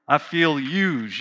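slow, low grumbled I feel used; mild mock complaint. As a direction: sulk the words I feel used a little. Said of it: "mumbling and complaining".

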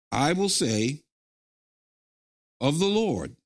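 slow, phrase by phrase, with long pauses for the congregation; slow call-and-response. Shown as a demonstration. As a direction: lead the room line by line. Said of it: "Say this with me".

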